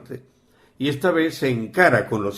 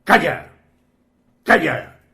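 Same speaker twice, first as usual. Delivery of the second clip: loud, higher, abrupt hushing order; two short, forceful bursts; retold sharp command; 5 semitones higher, 5 dB louder, slower than usual. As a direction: act out silencing a screaming boy. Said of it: "cuando el chico grita, le dice".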